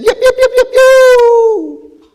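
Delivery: very high, loud yipping call; shepherd's call. Retold or imitated. Second shepherd calling his flock, high yipping shout. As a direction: yip sharply to gather the sheep. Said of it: "he said".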